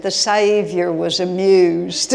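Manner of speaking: put-on loud, drawn-out Southern accent; hammed-up Southern accent with laughter near the surface. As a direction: imitate a Southern accent, slow and amused. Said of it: "he said it with a Southern accent".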